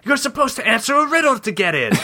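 high put-on knocker voice; exasperated left head. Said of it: "said the left head".

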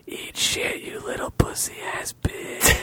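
hissed ghostly whisper, slow; a haunted wind breathing insults; eerie wind whisper. A narrator's reading. Storm wind taunting Gregory, slow eerie whisper. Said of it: "as the wind continued to howl eerily".

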